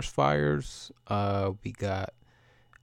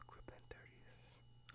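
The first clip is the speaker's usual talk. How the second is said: very slow, soft whispered aside; 30 dB quieter, much slower than usual. Real, not acted. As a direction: barely breathe the words out to yourself. Sighs then mutters to himself.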